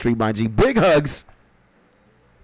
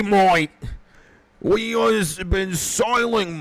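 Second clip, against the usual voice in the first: high, drawn-out mock Australian pirate; pseudo-Australian accent; 11 semitones higher, slower than usual. Pirate streamer hailing a returning friend, in a high pseudo-Australian accent. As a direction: put on an exaggerated Australian sailor's accent, pitched up. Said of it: "in the pseudo-Australian accent".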